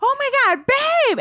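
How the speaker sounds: high-pitched mock panic; alarmed waking call. Woken partner imitating the alarm over a bug, high mock panic. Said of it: "alarmingly wake me up".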